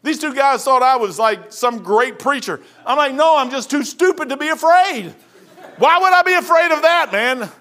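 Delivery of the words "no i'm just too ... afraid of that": high, loud, incredulous comeback; bold protest. Retold or imitated. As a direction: scoff loudly at being afraid. Acts out his bold answer.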